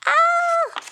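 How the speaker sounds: very high, loud startled shriek; alarmed shriek. A narrator's reading. Hedgehog seeing the dragon kite, high frightened shriek. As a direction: let out a frightened little shriek.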